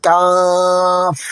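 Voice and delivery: কাফ drawn out long and thin in recitation; কাফ as a long, light recitation; light Qaf. Shown as a demonstration. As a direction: sing out কাফ slowly and lightly. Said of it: "পাতলা করে উচ্চারণ করতে হবে".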